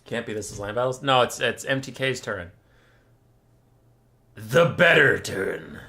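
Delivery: ordinary talk, nothing marked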